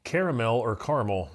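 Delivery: caramel first as three-syllable "CARE-uh-mel", then "CAR-mul"; two pronunciations of caramel. Shown as a demonstration. Putting a debate to co-hosts, caramel said both ways. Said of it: "I'm gonna throw this out there".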